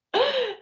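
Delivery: quick, high-pitched burst of laughter; short high laugh. Real, not acted. Medium amused by the cheeky spirit's boast, short high laugh. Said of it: "I'm laughing 'cause this gentleman".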